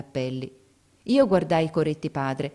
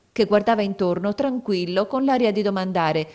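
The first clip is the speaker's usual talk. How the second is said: usual speaking voice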